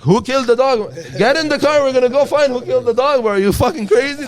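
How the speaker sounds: loud, very high frantic demand; mock outrage. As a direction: shout it in hammed-up indignation. Dad's feigned outrage acted out again.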